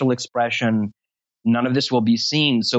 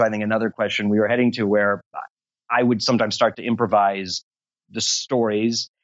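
ordinary talk, nothing marked

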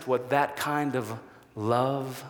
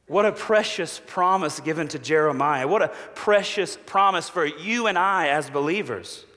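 usual speaking voice

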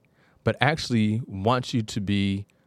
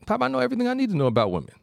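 lightly voiced eager son, high and fast; a boy bragging to his pop, quick and high; cocky teen son; 14 semitones higher, much faster than usual. Son brags to father in folktale.

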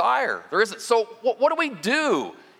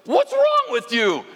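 high, loud, impatient snap at his wife; hammed-up grumpy old man barking to hurry; impatient old husband; 10 semitones higher, 4 dB louder than usual. Pastor acts out old man snapping at his waiting wife, impatient and loud. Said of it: "he looks back and he goes".

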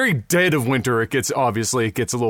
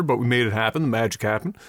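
normal talking voice

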